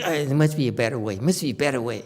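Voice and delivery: soft, fast, whiny grumble; a teacher mocking a pupil's complaint; mock whine. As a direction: mimic a student pleading for a shortcut. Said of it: "stop whining".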